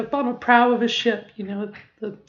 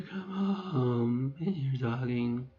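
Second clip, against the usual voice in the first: much lower, slow register; 8 semitones lower, 5 dB quieter, slower than usual. Shown as a demonstration. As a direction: let the voice sink down slowly. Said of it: "lower my voice here".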